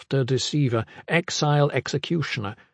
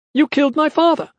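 very high, loud accusing cry; youthful shout; 5 dB louder than usual. A narrator's reading. Sixteen-year-old accuses the man who killed his father, shouting. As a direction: shout it as a hot-headed teenager. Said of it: "a sixteen-year-old fiery-haired green apple shouted".

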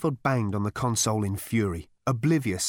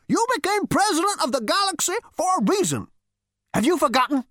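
put-on high, scornful old man; ghostly great-grandfather scolding; crotchety old ghost; 4 dB louder than usual. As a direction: sneer down at the useless great-grandson. Ghost reminds.

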